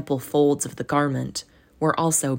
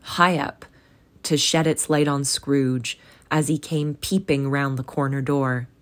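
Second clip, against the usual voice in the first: ordinary delivery, nothing marked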